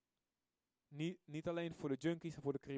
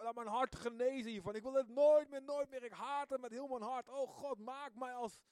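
crying out to God; 6 semitones higher than usual. Retold or imitated. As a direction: beg for forgiveness, rising into an outcry. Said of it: "Ik schreeuwde het uit". (smekend, opbouwend naar een hoge uitroep)

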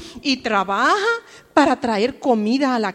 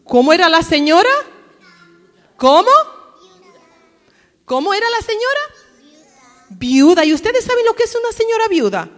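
llamando a los niños, más fuerte y agudo; lánzales la pregunta con energía; pregunta en voz alta; 6 semitones higher, 10 dB louder, faster than usual. Real, not acted. (maestra pregunta fuerte a la clase, en voz alta)

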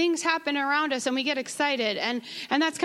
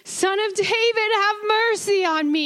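much higher, straining plea; imagine the beggar crying out all the more; desperate cry; 9 semitones higher than usual. Retold or imitated. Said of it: "what it must have sounded like".

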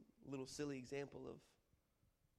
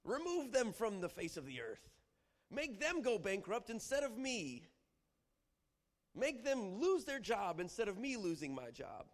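slow, high, forceful imprecation; harsh curses; 7 semitones higher, 4 dB louder, slower than usual. Acted. Preacher acting a believer cursing an enemy. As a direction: call down wrath on the enemy. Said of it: "harsh curses against your enemy".

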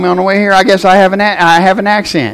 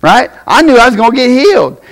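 ordinary talk, nothing marked

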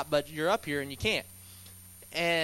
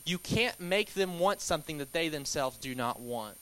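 normal talking voice

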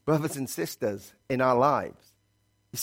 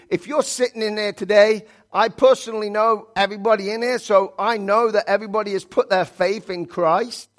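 fiery preaching; 5 semitones higher, 4 dB louder than usual. Acted. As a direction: preach it to the whole church, stretching key words. Preaching at full force.

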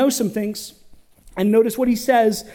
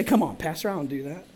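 mock denial; 4 semitones lower, 6 dB quieter than usual. Imagined congregant denying.

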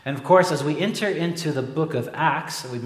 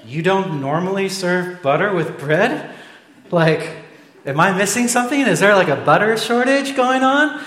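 mock disbelief; 6 semitones higher, 6 dB louder than usual. Incredulous reply to the waiter.

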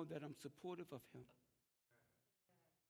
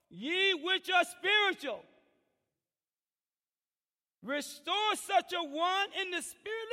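loud, high, slow scripture read; preached scripture reading; 10 semitones higher, 10 dB louder, slower than usual. Acted. Pastor preaching Galatians to the church, loud and slow. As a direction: proclaim the verse with feeling.

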